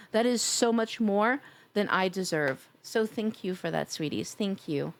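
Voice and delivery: ordinary talk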